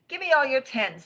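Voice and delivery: high, loud, brisk request; card-game demand. Acted. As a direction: eagerly demand the cards. Rabbit demands cards in game.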